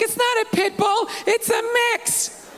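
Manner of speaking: breezy, sung-out denial; girlfriend's voice. Retold or imitated. Girlfriend on Skype insisting the dog is a mix, singsong. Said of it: "She's like".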